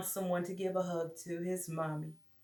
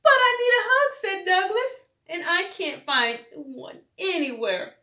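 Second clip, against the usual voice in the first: high tearful wail; crying plea; 11 semitones higher, 5 dB louder than usual. A narrator's reading. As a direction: whimper it, nearly crying. Douglas crying.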